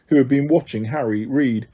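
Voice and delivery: ordinary delivery, nothing marked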